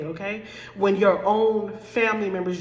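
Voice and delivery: ordinary talk, nothing marked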